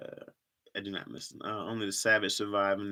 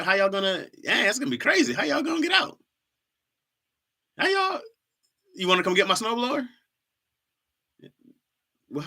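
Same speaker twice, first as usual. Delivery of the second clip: much higher, incredulous teasing rant; climbing higher and higher in fake shock; mock disbelief; 10 semitones higher, 4 dB louder than usual. Mock disbelief about no snowblower.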